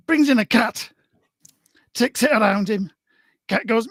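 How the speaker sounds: storytelling in a northern lilt; broad northern storyteller; northern accent. Acted. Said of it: "conjured up your inner northerner".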